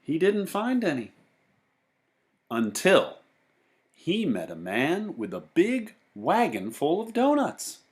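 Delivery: lively read-aloud voice for a class; storybook narration. A narrator's reading. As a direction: read it to the kids, with bounce and wonder. Teacher reads picture book aloud.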